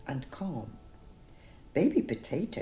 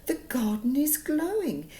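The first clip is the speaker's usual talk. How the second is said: mildly worried; 8 semitones higher than usual. Acted. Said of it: "cried Baby Potato softly".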